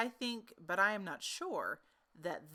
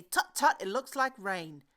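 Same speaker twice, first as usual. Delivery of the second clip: tut tut; 6 dB louder than usual. Acted. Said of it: "look up at me every now and then and say".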